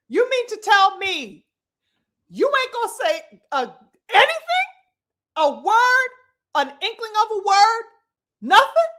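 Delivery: high, loud, rising disbelief; incredulous rant. Real, not acted. Ranting at Joshua's silence.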